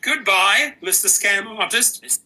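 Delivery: high, sing-song taunt of a goodbye; high mocking farewell to a fake caller. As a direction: wave off the scammer with a high sneer. Mock farewell to scam call.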